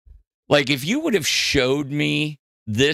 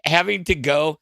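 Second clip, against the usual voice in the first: normal talking voice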